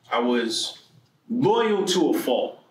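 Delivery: ordinary talk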